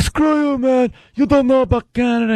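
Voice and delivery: high, shouted mock outrage; mock angry heckler. Comic retelling of the angry Canadian heckler, high and indignant.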